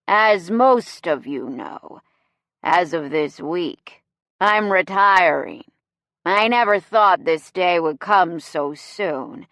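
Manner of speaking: slow, tipsy speech to a room; sloshed host addressing the crowd; drunken slur. A narrator's reading. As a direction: drag the words out boozily. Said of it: "He slurs into the mic".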